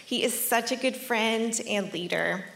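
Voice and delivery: normal talking voice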